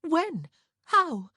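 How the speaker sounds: very high, loud, startled questions; shocked gasp. A narrator's reading. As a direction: fire out gasped questions in alarm. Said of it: "Prudence gasped".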